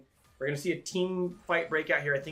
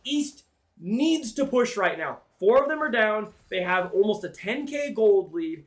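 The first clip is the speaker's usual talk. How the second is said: excited play-by-play; 6 semitones higher, 4 dB louder than usual. Real, not acted. Caster hypes near game end.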